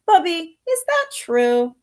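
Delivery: put-on high, eager child's question. Children asking their grandmother after a tale, eager and high. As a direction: ask grandma like a curious kid.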